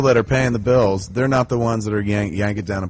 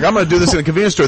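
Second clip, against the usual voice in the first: quick, high, louder mock quote; mock filmmaker pitch; 7 semitones higher, 4 dB louder, much faster than usual. Comparing indie films, quick mock pitch. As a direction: rattle off the imitated pitch in a raised voice.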